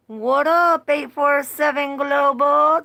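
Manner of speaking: high, loud shout-out to a viewer; excited greeting. Real, not acted. Greeting a live chat viewer, excited and loud. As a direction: call out to the chat with glee.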